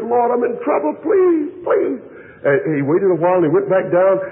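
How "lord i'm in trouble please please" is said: pleading prayer, voice climbing high; a man crying out to God for help, pleading high; desperate plea. Retold or imitated. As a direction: beg the Lord, rising into the please.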